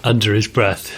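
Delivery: usual speaking voice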